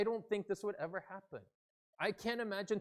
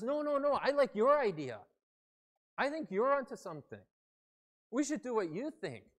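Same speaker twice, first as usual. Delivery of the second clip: high, loud enthusiastic deference; eager insistence; 8 semitones higher, 9 dB louder than usual. Acted. Other side insisting on the first one's idea, emphatically. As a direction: outdo the other in giving way, loudly. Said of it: "imagine if the other side says".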